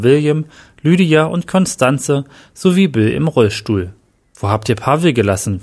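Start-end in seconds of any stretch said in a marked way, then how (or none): none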